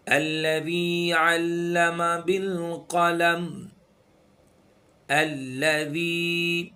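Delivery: slow, melodic tajweed chant; Quran recitation. Shown as a demonstration. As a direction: recite slowly, with each sound drawn out.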